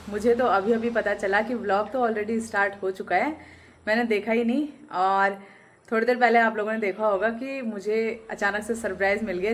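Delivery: ordinary delivery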